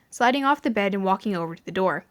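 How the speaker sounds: ordinary talk, nothing marked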